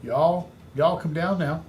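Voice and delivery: lightly voiced Southern y'all, slowed; a touch of Southern drawl on y'all come down now; Southern vernacular. Shown as a demonstration. Coach imitating a Southern seller, light Southern accent, slowed. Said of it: "if they're using vernacular".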